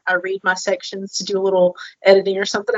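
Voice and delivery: ordinary talk, nothing marked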